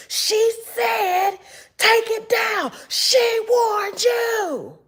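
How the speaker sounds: a mock tattletale voice; mocking mimic. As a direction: parody her warning in a high put-on voice. Mocking the quoted critic.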